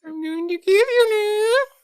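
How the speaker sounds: put-on very high crying baby voice. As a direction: whimper it like a wailing baby. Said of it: "have a baby, baby voice to match the mask".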